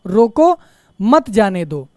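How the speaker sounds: "रोको" held up on a rise, short pause, then "मत जाने दो" falling; pause after रोको. Shown as a demonstration. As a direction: stop briefly after रोको, then say मत जाने दो as one unit. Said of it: "यानी थोड़ा रुकना".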